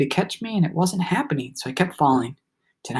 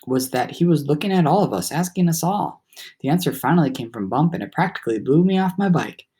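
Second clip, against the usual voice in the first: ordinary delivery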